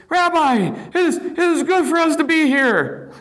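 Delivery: high, fumbling Peter babbling on the mountain; flustered Peter. Acted. Preacher voicing Peter on the mountain, high and flustered. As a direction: blurt it out like an awestruck fisherman.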